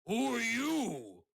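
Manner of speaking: slow, loud, high ogre challenge; booming ogre voice. Acted. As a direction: call out slowly like a huge ogre.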